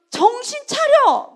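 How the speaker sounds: a friend yelling at her to snap out of it; harsh yell. Retold or imitated. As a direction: bark it out to wake her up. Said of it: "센 소리를 해주고 싶더라고".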